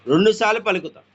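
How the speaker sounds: usual speaking voice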